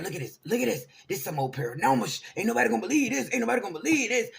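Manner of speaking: freaked-out excitement. Retold or imitated. Friend showing her the orb on his phone. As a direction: push the phone at her, frantic. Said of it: "he's really freaking out".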